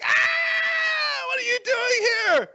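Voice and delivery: high, drawn-out shriek of alarm; screaming. Acted. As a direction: scream at an unwelcome intruder. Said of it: "and she's screaming".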